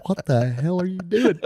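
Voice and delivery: hammed-up parental despair; mock-distraught father. Father confronts daughter by phone, mock-distraught.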